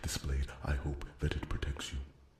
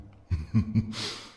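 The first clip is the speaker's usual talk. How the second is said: short shy laugh, pitched higher and louder; bashful laugh; 5 semitones higher, 6 dB louder than usual. Acted. The smith laughs shyly as he bids his queen farewell, higher bashful laugh. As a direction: let out an embarrassed little laugh.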